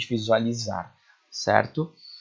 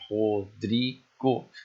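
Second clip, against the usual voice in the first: slow, split into separate syllables; much slower than usual. Shown as a demonstration. Teacher shows students how to count grammatical syllables, syllable by syllable. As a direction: break the name into its syllables, pausing between. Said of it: "contar as sílabas gramaticais".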